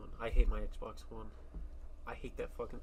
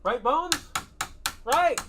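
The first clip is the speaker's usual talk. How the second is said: shouting; 9 dB louder, much slower than usual. Pretend. Shouting to skeleton.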